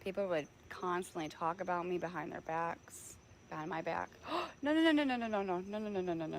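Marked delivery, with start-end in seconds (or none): 4.3-6.4 gasped, high, then fading; alarmed no's. Real, not acted. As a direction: start panicked, settle down. Dog suddenly caught, alarmed.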